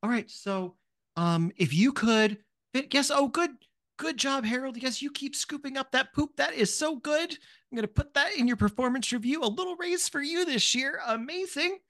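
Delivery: high, sugary boss praising the workers; overly sweet supervisor cheering on the staff; kindly manager. Acted. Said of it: "very kindly being like".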